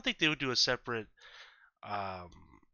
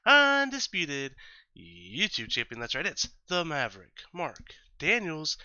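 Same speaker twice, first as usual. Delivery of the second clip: grand entrance announcement; 4 dB louder than usual. Pretend. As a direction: hype yourself up like a wrestling ring announcer. Wrestling-style self introduction.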